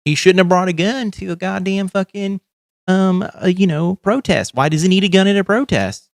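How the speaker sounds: a hammed-up mock of a right-wing talking head; mocking imitation. Mocking critics of the shooting victim.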